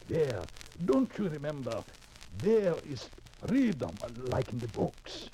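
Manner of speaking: put-on hopeful man in a European immigrant accent. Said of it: "With that accent?".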